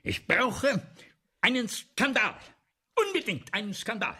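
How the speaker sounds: a frantic director demanding a scandal; desperate demand. Acted. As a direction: beg for a scandal.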